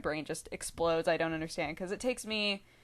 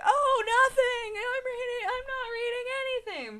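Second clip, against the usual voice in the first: flustered panic; 3 dB louder than usual. Retold or imitated. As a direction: deny everything in a frantic, much higher voice. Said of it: "flip out".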